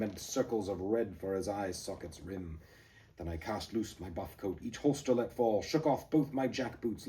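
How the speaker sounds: galloping dramatic reading; poem read at a breakneck pace; poem recitation. Acted. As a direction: drive the verse forward.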